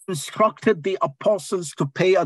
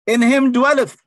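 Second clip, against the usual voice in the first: called out phrase; 7 semitones higher, 6 dB louder than usual. Real, not acted. Preacher calls phrase to congregation.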